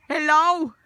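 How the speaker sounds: high, loud call to the dog; loud greeting. Real, not acted. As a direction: call out hello across the yard.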